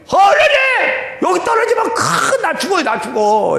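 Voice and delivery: high loud panicked yelling; panicked cry. Retold or imitated. Deacon looking down the cliff screaming in panic to God.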